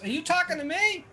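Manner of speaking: high questioning voice; juggler puzzled. Acted. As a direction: ask Foss in surprise. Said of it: "he looks at Foss".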